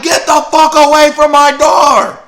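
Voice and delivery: put-on high, loud screaming girl; furious shout. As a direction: scream at her mother to get away. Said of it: "She's like".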